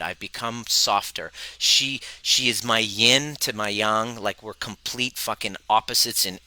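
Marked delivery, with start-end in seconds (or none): none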